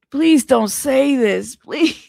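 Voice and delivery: playfully desperate plea, slow and insistent; pleading. Pretend. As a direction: beg dramatically, stretching the words out. Begging the caller not to.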